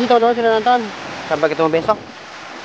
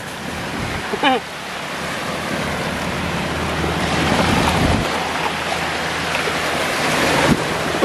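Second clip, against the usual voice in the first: long breathy laugh; 8 semitones higher, 4 dB quieter than usual. Real, not acted. Laughing at own camera joke.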